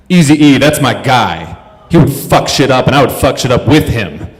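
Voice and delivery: loud, acted rowdy moviegoer; imitating aggressive shouting. Man shouting at the movie screen, mock-aggressive. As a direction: shout it out like a hyped-up heckler. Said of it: "talking very aggressively".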